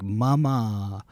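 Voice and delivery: mock toddler wail, drawn out; imitated bawling. Parent imitates daughter crying after bedtime scolding, mock bawling. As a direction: lightly mimic a bawling kid. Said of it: "She starts bawling".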